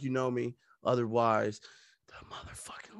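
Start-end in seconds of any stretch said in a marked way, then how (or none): 2.1-3.0 menacing joking whisper. Acted. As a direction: hiss the threat as a joke. Whispered threat punchline.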